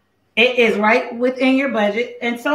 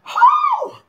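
very high, drawn-out "whew"; high falsetto whew; high-pitched whoop. Real, not acted. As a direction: let out a piercing high whoop. Had to pat it down.

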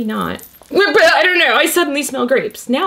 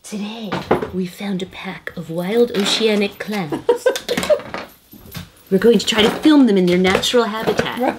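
hushed documentary narrator; nature documentary; 4 semitones lower than usual. Pretend.

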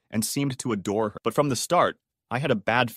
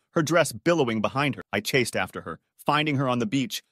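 ordinary delivery

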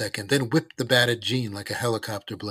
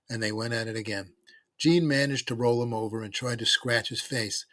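plain reading voice

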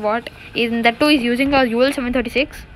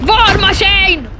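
loud, high, drawn-out holler; hyped gamer shout; excited shout; 8 semitones higher, 5 dB louder, much slower than usual. Real, not acted. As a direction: bellow it slowly at a high pitch. Calling out game event.

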